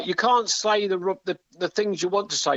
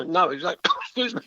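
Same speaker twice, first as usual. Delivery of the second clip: coughing fit. Real, not acted. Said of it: "You're dying over there".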